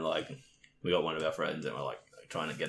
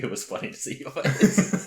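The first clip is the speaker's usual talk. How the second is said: higher and louder through laughter; laughing; 7 semitones higher, 8 dB louder than usual. Retold or imitated. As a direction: confess it through giggles. Laughing admission.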